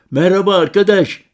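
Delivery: loud, high call into the dark; friendly shout. Acted. Narrator shouting a greeting to a stranger, loud and high. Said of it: "diye bağırdım".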